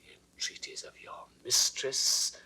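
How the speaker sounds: hushed, incredulous repeat; whispered jealous echo; bitter whisper. Acted. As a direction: hiss the words back at him. Jealous king repeats Camillo's words.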